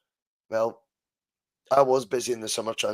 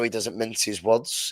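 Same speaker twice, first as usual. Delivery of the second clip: ordinary delivery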